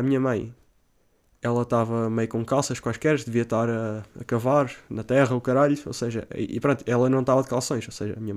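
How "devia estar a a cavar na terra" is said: usual speaking voice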